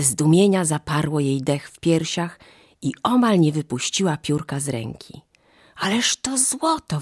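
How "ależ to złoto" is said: high-pitched cry of amazement; astonished exclamation. A narrator's reading. Mother sees the feather turn gold, astonished high exclamation. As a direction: exclaim in wonder, voice lifted high. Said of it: "wykrzyknęła".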